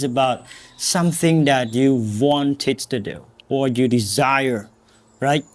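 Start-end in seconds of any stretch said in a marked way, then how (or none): none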